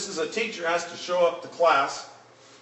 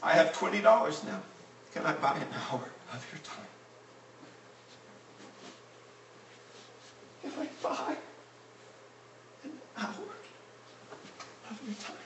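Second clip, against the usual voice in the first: real tears breaking up the words, voice fading high and faint; choked up; 6 semitones higher, 8 dB quieter than usual. Real, not acted. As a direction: struggle to get through it, long halting pauses. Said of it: "I hope I can get through it".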